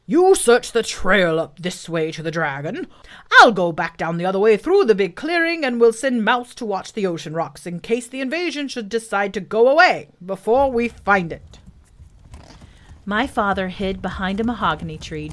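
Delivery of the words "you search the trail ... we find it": loud boar giving orders; commanding character voice of a wild boar; bossy boar. Acted. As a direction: dish out orders as the lead boar.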